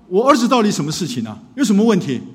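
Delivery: loud, high demanding challenge; father barging in, confronting the counselor; angry father. Retold or imitated. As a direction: demand answers, no courtesy at all.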